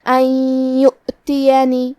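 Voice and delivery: ان یؤتینی with the نون merged into a long nasal ghunna; slow tajweed demo. Shown as a demonstration. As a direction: stretch ان یؤتینی slowly, nasal ghunna held with shadda. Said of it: "غنہ اور تشدید کے ساتھ ادا کریں گے".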